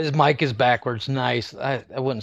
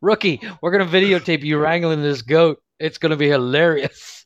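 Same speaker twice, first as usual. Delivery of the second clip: cop voice through laughter; laughing cop voice; 5 semitones higher, 5 dB louder than usual. Retold or imitated. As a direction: laugh while voicing the cop. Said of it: "They're like".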